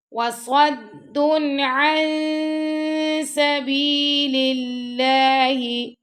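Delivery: very slow melodic chant; Quran recitation. Shown as a demonstration. Teacher recites for pupils, slow melodic chant. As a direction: stretch the vowels in recitation. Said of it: "teacher demonstrates recitation".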